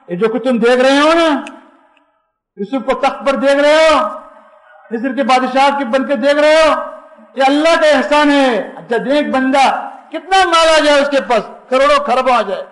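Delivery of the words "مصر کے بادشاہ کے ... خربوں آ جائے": usual speaking voice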